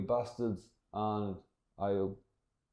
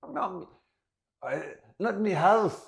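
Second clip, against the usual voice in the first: hammed-up high voice, louder at the end; mock distraught woman; 9 semitones higher, 4 dB louder than usual. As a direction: wail in mock dismay at losing a home. Woman protests at her house being repossessed.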